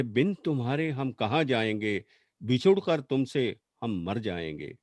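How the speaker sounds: measured, low-pitched pleading verse; poetry recital. Acted. Poet voicing a wife pleading with her beloved, low measured recital.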